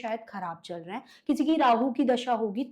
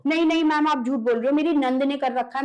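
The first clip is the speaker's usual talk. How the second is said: loud, higher-pitched protest; insistent client; 4 semitones higher, 8 dB louder than usual. Retold or imitated. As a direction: imitate a client insisting against the verdict. Acts out clients protesting her verdict. (ज़ोर देकर विरोध की नकल)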